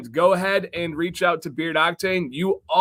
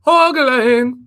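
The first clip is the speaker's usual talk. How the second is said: much higher mock Irish "Hoagland"; Irish accent; 10 semitones higher, 5 dB louder than usual. Host repeats the name in a mock Irish accent. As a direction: sing "Hoagland" like a stage Irishman. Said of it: "the Ireland accent of how you say".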